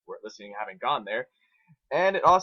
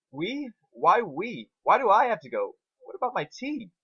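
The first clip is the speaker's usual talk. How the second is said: whiny protest; 9 semitones higher, 5 dB louder than usual. Acted. Spike protesting going out.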